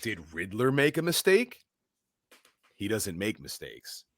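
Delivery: normal talking voice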